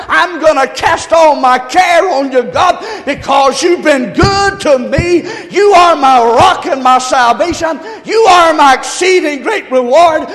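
loud, high prayer of praise; congregation's shouted prayer. Acted. Pastor voicing the church's reply, shouted praise. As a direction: shout the church's answer to God. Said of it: "And the church said".

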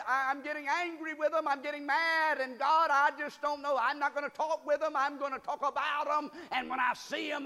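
a churchgoer venting angrily to the Lord; frustrated complaint. Retold or imitated. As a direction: play the believer fuming about people. Voicing a frustrated believer's complaint.

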